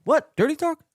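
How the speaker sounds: high, loud disbelieving "what? Dirty talk?"; shocked, can't believe it; squeaky disbelief; incredulous. Real, not acted. Incredulous reaction to a clip.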